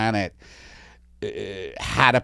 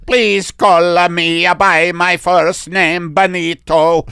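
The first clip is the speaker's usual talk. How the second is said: slow, higher, louder Italian; Italian alien accent; 6 semitones higher, 6 dB louder, slower than usual. Pretend. Italian alien telling the doctor his first name, Italian accent. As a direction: introduce yourself grandly in Italian. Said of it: "Italian alien".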